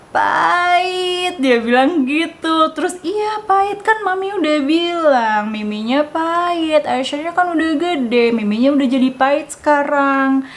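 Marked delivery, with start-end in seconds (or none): 0.1-1.4 put-on high, drawn-out childish whine; whining toddler. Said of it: "dia langsung". 3.0-10.4 coaxing mommy voice. Retold or imitated. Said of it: "Aku bilang kayak gitu".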